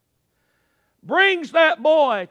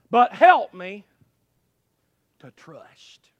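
loud start, then a long pause and a whisper; plea sinking to whisper; 4 semitones lower, 4 dB louder, slower than usual. Acted. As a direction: let the plea drop away to a whisper.

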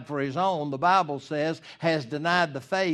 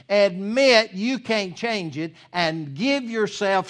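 louder, rising into fervent preaching; preaching at full force; 4 semitones higher, 6 dB louder than usual. Acted. Pastor urging the congregation to repent, preaching at full force.

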